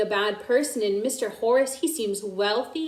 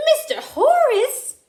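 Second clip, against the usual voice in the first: much higher, louder disbelief; shocked; 13 semitones higher, 5 dB louder than usual. A narrator's reading. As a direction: gasp out the name. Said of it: "Grandmother looked shocked".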